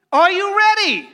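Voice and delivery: loud, high, rousing question to the crowd; preacher firing up the congregation, loud and high; rallying call. Real, not acted. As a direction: pump the room up. Pastor rallies congregation, calling out.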